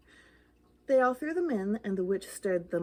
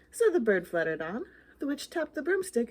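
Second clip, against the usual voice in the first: ordinary delivery, nothing marked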